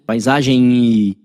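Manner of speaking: PAISAGEM with the ending drawn out into an I; final I sounded. Shown as a demonstration. Teacher showing the hidden I at the word's end, final I sounded. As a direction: stretch the last nasal vowel of "Paisagem" into a clear "I". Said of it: "no final tem um I".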